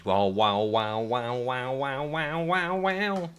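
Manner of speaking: one long string of wows sliding upward; rising run of wows. Real, not acted. Mock wailing while climbing.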